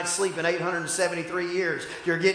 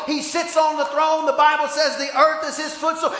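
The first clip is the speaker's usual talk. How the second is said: rising, loud sermon cadence; fiery preacher building to a shout; preaching; 7 semitones higher, 6 dB louder than usual. Real, not acted. Preaching at full force.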